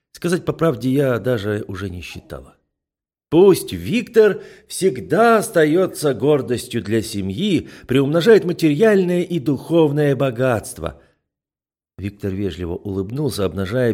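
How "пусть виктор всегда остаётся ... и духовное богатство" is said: put-on loud, slow, drunk uncle toast; tipsy toast. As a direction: raise the glass, tipsy and loud.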